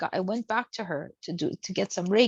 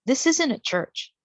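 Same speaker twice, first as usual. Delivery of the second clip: mock-indignant boss, higher and louder; retold irritation; 5 semitones higher, 4 dB louder than usual. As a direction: imitate the annoyed supervisor scolding. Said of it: "he got really upset".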